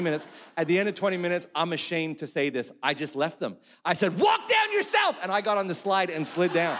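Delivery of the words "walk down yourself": much higher, louder snap; dad yelling at daughters before sliding off; exasperated yell. Retold or imitated. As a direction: bark it and walk away. Dad leaving the girls.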